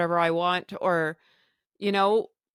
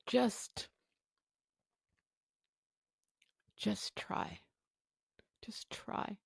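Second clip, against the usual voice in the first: softer, near whisper, just try; whispered urging; 12 dB quieter, much slower than usual. Retold or imitated. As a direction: gently urge her to try, almost whispering. Doctor urging her softly.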